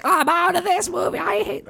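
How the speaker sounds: high, whiny outraged viewer; outraged moviegoer. Retold or imitated. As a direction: squeal it like an offended audience member. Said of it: "and people are like".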